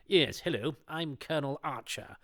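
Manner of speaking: lightly voiced pompous colonel; cliched colonel. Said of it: "your cliched".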